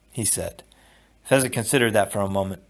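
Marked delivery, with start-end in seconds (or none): none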